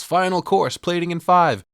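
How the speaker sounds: high, loud kitchen call; yelling. Retold or imitated. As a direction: bark the order across the kitchen. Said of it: "and he yells".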